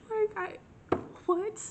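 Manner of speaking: squeaky high in disbelief; disbelieving. Real, not acted. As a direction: cry out in disbelief.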